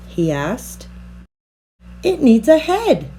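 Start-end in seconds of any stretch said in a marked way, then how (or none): 2.0-3.1 higher eager exclaim; a child's happy idea; higher, eager girl's cry; excited cry. A narrator's reading. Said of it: "cried little Nell".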